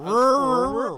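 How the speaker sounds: high, loud shouted word. Pretend. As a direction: yell it out. Repeating rural, escalating.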